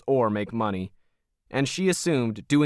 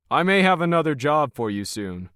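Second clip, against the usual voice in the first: sly; 4 semitones higher than usual. A narrator's reading. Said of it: "He smiled slyly".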